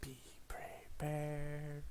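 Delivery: slow breathy whisper. Acted. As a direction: hush it out slowly. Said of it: "Be fucking prepared".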